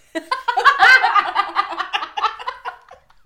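big high burst of laughter; loud laugh. Real, not acted.

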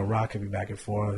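usual speaking voice

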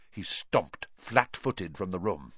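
ordinary delivery, nothing marked

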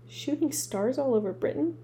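ordinary delivery

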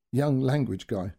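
ordinary talk

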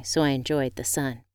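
ordinary delivery, nothing marked

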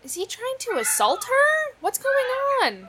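high shocked disbelief. Real, not acted. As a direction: gasp it out in disbelief. Shocked at the movie.

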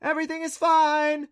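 loud, high reassuring shout; girl covering up to her dad; yelling back. A narrator's reading. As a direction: shout it across the camp. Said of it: "Clancy yelled back".

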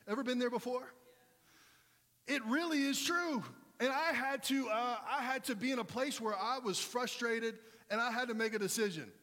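high, loud preaching cry; a pastor firing up the congregation, loud and high; preaching at full force. Acted. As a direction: preach it out hard to the crowd. Preacher peaks again.

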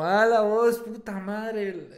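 half-playful shocked swearing that drags out at the end; dismayed curse. Retold or imitated. Friend realizing the car papers are gone, dismayed curse.

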